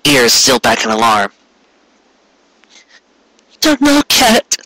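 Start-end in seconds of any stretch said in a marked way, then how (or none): 3.5-4.6 high, trembling tearful voice. Acted. Cupcake to Carrot, high and close to tears. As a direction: hold back tears as she speaks. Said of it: "she said while tears gathered".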